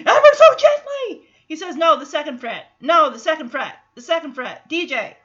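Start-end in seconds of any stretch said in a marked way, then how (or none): 0.1-1.3 high, very loud yell with a laugh; hammed-up shrieked instruction; mock yelling. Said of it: "Instead of yelling". 1.8-5.2 loud, insistent correction; mock scolding from a frustrated teacher; impatient dad. Retold impatient dad correcting guitar lesson.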